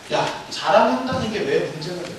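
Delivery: ordinary delivery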